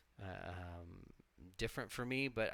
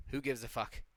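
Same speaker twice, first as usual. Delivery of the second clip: ordinary talk, nothing marked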